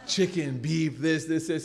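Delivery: laughing through the mock food list; chuckling as he points dish to dish; laughing imitation. Speaker laughing while mocking the buffet list.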